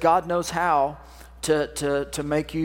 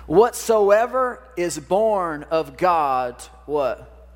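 drawn-out, weighty reading; slow preached verse over music; slow solemn scripture reading; 4 dB louder, much slower than usual. Acted. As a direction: read it like a proclamation, slowly. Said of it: "this is God speaking to you this morning".

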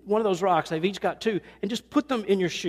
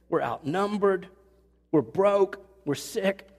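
usual speaking voice

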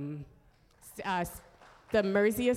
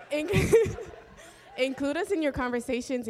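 laughing; 8 semitones higher, 8 dB louder than usual. Real, not acted. Laughs while asking to be included.

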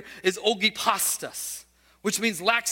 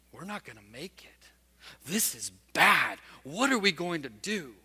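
hushed fearful whisper of a private worry; frightened disciple whispering to himself in the storm; whispered inner thought; 7 semitones lower, 6 dB quieter than usual. Retold or imitated. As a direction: breathe it like a thought nobody hears. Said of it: "with a thought bubble, not something audibly".

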